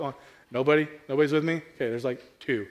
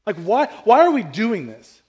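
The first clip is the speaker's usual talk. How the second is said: Moses pleading with God, raised and louder; louder, exasperated questioning of God; frustrated Moses; 6 semitones higher, 6 dB louder than usual. Retold or imitated. Preacher voicing Moses complaining to God, exasperated and louder. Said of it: "He's like".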